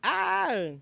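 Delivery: very high, loud squeal; excited squeal. Real, not acted. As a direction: let out a big happy squeal. Squeal after kisses.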